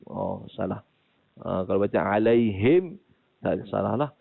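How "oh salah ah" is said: normal talking voice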